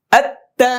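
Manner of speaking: আত্তা with the ত held double and punched high; doubled consonant demo. Shown as a demonstration. Teacher models আত্তা for the student to repeat, high and loud. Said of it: "তাসদিদ ওয়ালা হরফ দুইবার পড়তে হয়".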